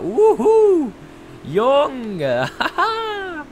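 high, loud excited whoop into laughter; thrilled whoop. Real, not acted. Risky stunt in game.

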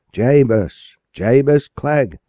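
high, loud slow calls across the water; crying out a name. Acted. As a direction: call the lost boy's name into the dark. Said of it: "he cried".

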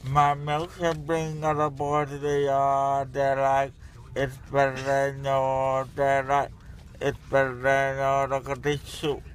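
groggy from anesthesia. Real, not acted. Drugged patient rambling.

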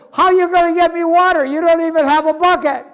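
incredulous woman. Acted. Woman scoffing at Jesus's offer of water, quick and high. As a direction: rattle it off in disbelief.

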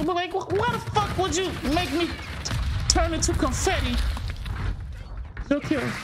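high, exasperated rant; outraged high complaint; angry rant. Real, not acted. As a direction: rant at the game's design. Ranting about dying in game.

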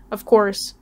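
plain reading voice

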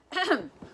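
a loud cough to clear the throat. Acted. Principal coughing before his announcement. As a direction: ahem before the speech. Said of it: "coughed Mister Lowe".

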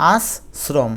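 আশ্রম broken as "as... srom"; careful pronunciation. Shown as a demonstration. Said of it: "এটা উচ্চারণ করতে গিয়ে দেখবে". (আশ্রম, দন্ত্য স দিয়ে ভেঙে)

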